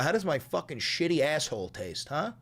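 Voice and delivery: ordinary talk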